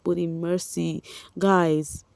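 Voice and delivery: normal talking voice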